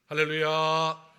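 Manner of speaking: loud, high, held-out hallelujah; ringing sustained shout of praise; hallelujah call. Real, not acted. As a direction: call it out to the whole congregation. Calls out greeting to congregation.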